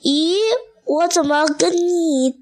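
mildly surprised little tadpole; puzzled tadpole. A narrator's reading. Tadpoles puzzled at looking different.

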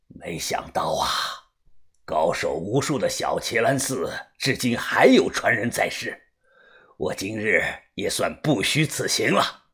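very low, soft voice; musing martial-arts master. A narrator's reading. Master muses on surviving temple heirs, low pensive voice. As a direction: murmur it deep and pensive. Villain muses with head lowered.

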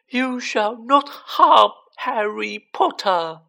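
high, loud, slow elf shout. A narrator's reading. Dobby defending Harry from Malfoy, shouting. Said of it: "But Dobby shouted".

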